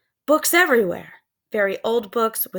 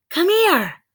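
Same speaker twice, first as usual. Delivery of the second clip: calling; 11 semitones higher, 5 dB louder than usual. A narrator's reading. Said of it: "called Annie".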